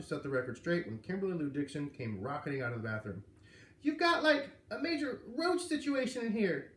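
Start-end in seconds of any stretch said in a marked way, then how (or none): 3.8-6.7 much higher, louder rasping crone, slowing down; exclaiming granny voice. A narrator's reading. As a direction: blurt the complaint like a crusty old granny. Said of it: "she exclaimed".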